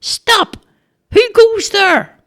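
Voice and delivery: very high, thin, drawn-out Stop; a nervous lad trying to sound fierce; squeaky challenge. A narrator's reading. Charlie challenges footsteps at the barricade, squeaky high call. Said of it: "and said".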